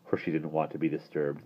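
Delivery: ordinary delivery, nothing marked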